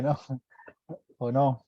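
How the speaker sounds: usual speaking voice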